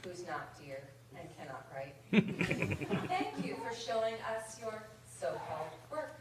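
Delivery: deadpan mock-formal letter read in verse; reading a mock rejection letter poem over audience laughter; comic poem reading. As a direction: read it like a snooty editor's form letter.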